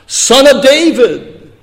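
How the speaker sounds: slow, high-pitched plea for mercy; crying out. Acted. Two blind beggars calling to Jesus, crying out. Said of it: "begin to cry out".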